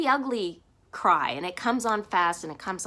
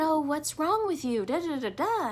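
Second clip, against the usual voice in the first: higher-pitched imitation of a questioning counselor; lightly mocked counselor; 8 semitones higher than usual. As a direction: mimic a concerned counselor, trailing into da-da filler.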